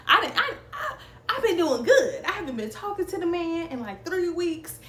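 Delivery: real emotion rising as she relives it; getting emotional. Real, not acted. Telling viewers about her ex, getting teary and pitched high. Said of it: "I'm about to cry. Like, I'm getting emotional".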